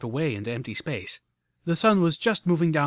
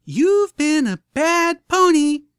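high, slow, loud robot scold; chirpy machine scolding slowly; mechanical Pinkie Pie; 10 dB louder, much slower than usual. A narrator's reading. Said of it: "like a mechanical Pinkie Pie".

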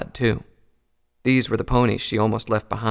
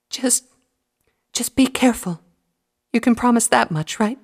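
holding back tears; 13 semitones higher, 5 dB louder than usual. Acted. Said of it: "choked back a sob".

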